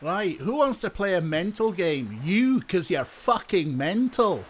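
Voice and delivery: irate Scottish game-show host; Malcolm Tucker. Acted. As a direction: pitch up, hurl the insult in Scottish. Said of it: "Malcolm Tucker hosting the Crystal Maze".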